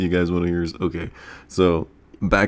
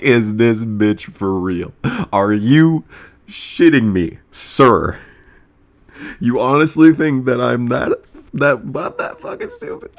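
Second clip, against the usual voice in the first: loud, disbelieving retort at the stranger; a louder mock telling-off, rising on stupid; hammed-up outraged disbelief; incredulous outburst; 3 semitones higher, 5 dB louder than usual.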